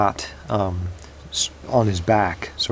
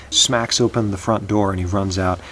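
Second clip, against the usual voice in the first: ordinary delivery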